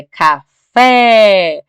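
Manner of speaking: "café" split as ca-fé, with a long loud FÉ; syllable by syllable. Shown as a demonstration. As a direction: separate the syllables of "café" and hold the last one. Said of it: "Se a gente separa a sílaba".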